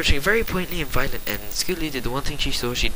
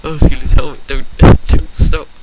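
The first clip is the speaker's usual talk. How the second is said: mock moaning; 2 semitones higher, 10 dB louder than usual. Rainbow Dash moaning again.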